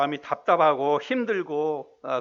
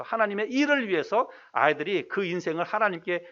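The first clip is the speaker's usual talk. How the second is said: ordinary talk, nothing marked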